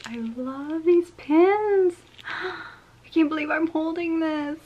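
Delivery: high, drawn-out gushing; delighted disbelief. Real, not acted. Collector holding a long-wanted pin, delighted gushing. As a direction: gasp and stretch it out adoringly.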